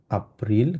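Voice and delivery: এপ্রিল slowly as "ah-pril". Shown as a demonstration. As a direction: open এপ্রিল on AH, not English AY. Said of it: "এটাকে হচ্ছে আ উচ্চারণ করি". (এপ্রিল-কে ধীরে "আপ্রিল")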